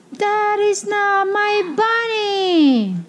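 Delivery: high, drawn-out protest; outraged child's slow, high complaint; indignant little girl. A narrator's reading. Trixie confronts her parents at night.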